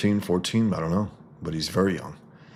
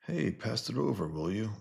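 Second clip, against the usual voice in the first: higher put-on thug voice; voicing a comic thug; 6 semitones higher than usual. Reading a comic panel to viewers, lightly voicing a thug. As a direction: toss off the line like a drunk tough guy.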